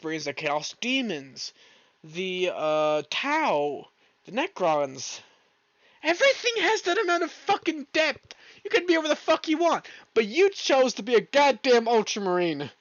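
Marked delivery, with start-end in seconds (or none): 6.0-12.7 high-pitched, worked-up scolding; indignant high rant at someone who chose wrong; yelling rant. Real, not acted. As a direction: yell it in high-pitched exasperation. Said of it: "why I was yelling you".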